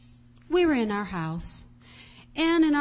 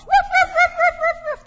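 rapid string of high, loud woofs; loud mimicked barking; imitated dogs barking; 11 dB louder than usual. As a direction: bark like dogs holding off a threat. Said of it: "he heard the dogs".

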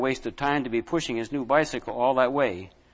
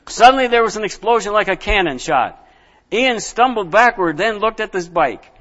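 higher, louder, gripping; dramatic burst; 7 semitones higher, 6 dB louder than usual. A narrator's reading. Reader telling the tire exploding, high and loud.

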